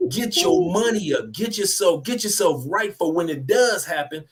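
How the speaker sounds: normal talking voice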